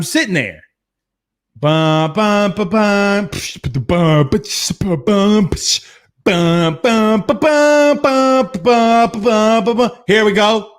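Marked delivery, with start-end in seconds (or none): 1.6-9.9 hummed drum and cymbal beat, pitch climbing toward the end; beatbox the wedding march buildup; mock wedding drumbeat. Waiting at the altar, he beatboxes the entrance music as mock drums.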